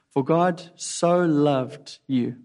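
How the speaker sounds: slow, leaning on "so" in "For God so loved you"; stress on so. Shown as a demonstration. Preacher telling listener personally, slow stress on "so". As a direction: stretch out "so", unhurried and earnest. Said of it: "Not God loved the world".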